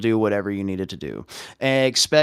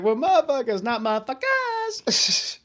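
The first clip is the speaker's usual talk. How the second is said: much higher, slowing cartoon voice; imitating character. Quoting a Trailer Park Boys character in a high goofy voice. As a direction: correct himself in a high goofy voice. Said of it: "he's like".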